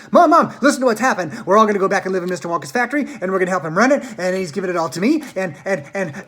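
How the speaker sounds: excited. A narrator's reading. Charlie telling his mother the news, excited and fast. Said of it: "cried Charlie, rushing into".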